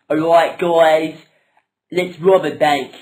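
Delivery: rally the troops to rob a bank; loud rallying call. Acted. Peter rallies the gang.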